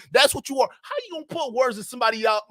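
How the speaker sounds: ordinary delivery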